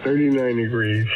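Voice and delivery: ordinary talk, nothing marked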